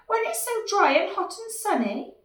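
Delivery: high, loud disbelief; alarmed little dinosaur. Acted. Dinosaur doubting the storm news, acted alarm.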